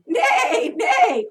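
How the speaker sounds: very high, loud laughing whinny; horse neigh. Shown as a demonstration. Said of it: "and say".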